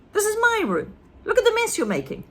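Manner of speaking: put-on high, loud and quick boy's protest; boy scolding his grandmother; indignant George.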